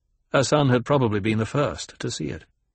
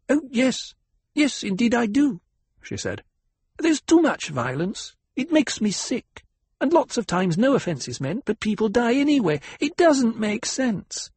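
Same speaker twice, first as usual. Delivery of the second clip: put-on much higher, girlish, gushing voice; empty-headed young girl; 12 semitones higher than usual. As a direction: play the silly society girl, high and breathy-bright. Stillsuit maker's daughter speaks.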